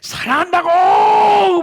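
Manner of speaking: high, loud yell of love; shouted at wife. Retold or imitated. Husband shouting at his wife in a quarrel, loud yell. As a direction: yell it out in frustration. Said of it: "막 소리를 질렀어".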